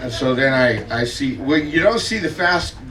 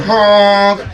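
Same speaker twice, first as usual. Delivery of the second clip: loud honk, pitched up; torpedo honk; 4 semitones higher, 6 dB louder than usual. Retold or imitated. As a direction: honk it like a horn at a cutter. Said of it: "and I'm going".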